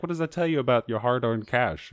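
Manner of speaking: ordinary talk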